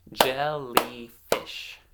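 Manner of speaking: "Jellyfish" slowly split as jel, ly, fish; three separate beats on "Jellyfish"; syllable by syllable. Shown as a demonstration. Teacher giving children a word to blend themselves, syllable by syllable. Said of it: "I'm gonna clap out".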